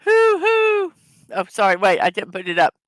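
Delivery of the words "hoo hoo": high, loud owl hoo hoo; owl hoot. Pretend. Streamer answers the knock-knock joke with an owl hoot. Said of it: "I think I hear an owl".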